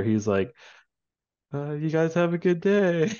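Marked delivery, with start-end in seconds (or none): 1.5-3.1 high-pitched, awkwardly polite send-off; strained mock pleasantry, pitched up; awkward ticket clerk. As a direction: imitate a clerk politely ignoring a tantrum. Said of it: "the guy working the tick-, ticket counter".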